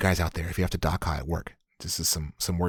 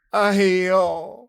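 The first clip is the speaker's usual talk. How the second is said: high, loud "aiyo" of dismay; exaggerated groaning interjection; exclaimed sigh; 11 semitones higher, 6 dB louder, slower than usual. Shown as a demonstration. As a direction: draw out a big exasperated "哎呦". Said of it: "exactly what it sounds like".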